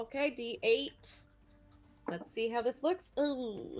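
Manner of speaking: usual speaking voice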